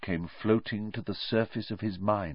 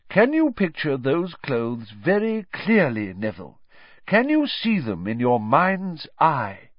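slow, higher-pitched teacher; deliberate, encouraging questions; Professor Lupin coaching; 6 semitones higher, 3 dB louder than usual. Acted. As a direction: guide the pupil to picture it.

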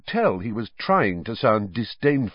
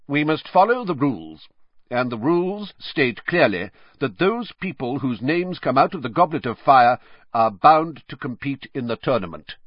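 curt; 5 semitones higher, 4 dB louder than usual. A narrator's reading. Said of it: "in his usual curt voice".

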